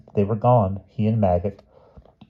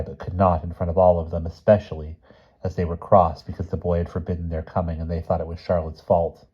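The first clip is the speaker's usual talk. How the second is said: ordinary delivery, nothing marked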